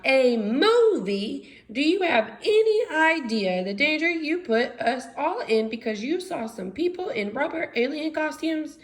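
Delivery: high, loud angry shout; furious scientist shouting at her assistant. Acted. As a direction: yell at Igor, outraged. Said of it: "Franny began to shout".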